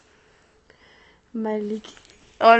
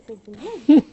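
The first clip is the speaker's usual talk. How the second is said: loud protest; 15 dB louder, slower than usual. Real, not acted. Protesting friend teasing on live.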